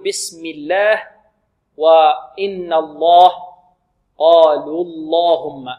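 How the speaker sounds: الله and اللهم with thick, full lam, drawn out slowly; heavy lam of Allah. Shown as a demonstration. Teacher reciting Quran examples, slow heavy lam. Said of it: "وفي القرآن نقول".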